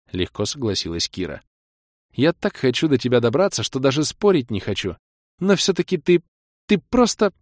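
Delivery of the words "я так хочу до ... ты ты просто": put-on high, rising voice of a frustrated young woman; eager, then annoyed; exasperated girlfriend. Kira torn between love and pique.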